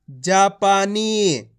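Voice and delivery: जापानी very slow, every long vowel held; drawn-out reading. Shown as a demonstration. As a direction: stretch out जापानी syllable by syllable. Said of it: "खींच खींच के पढ़ना है".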